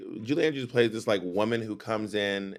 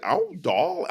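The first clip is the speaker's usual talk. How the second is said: put-on Long Island accent; 7 semitones higher than usual. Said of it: "goes into this Long Island accent".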